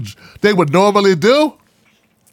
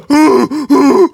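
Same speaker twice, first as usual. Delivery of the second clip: loud, high fake sob gasp; hammed-up sob intake; mock crying gasp; 6 semitones higher, 5 dB louder than usual.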